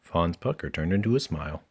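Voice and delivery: ordinary delivery, nothing marked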